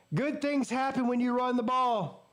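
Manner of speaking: high-pitched, triumphant lecture; excited vindication. Real, not acted. Vindicated after the touchdown.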